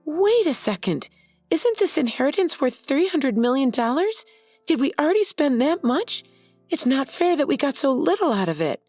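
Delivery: high, rushed alarm; panicked. Acted. Mary panicking over the lost inheritance, high and alarmed. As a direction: scramble as the money slips away. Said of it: "Mary panicked".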